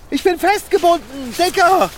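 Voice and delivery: frantic plea to his friend; panicked cry for help. Acted. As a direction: yell for help, tied down and scared. Tied up, calls for help.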